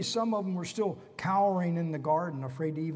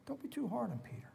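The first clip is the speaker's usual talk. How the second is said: soft repeat; 6 semitones higher, 10 dB quieter, faster than usual. Shown as a demonstration.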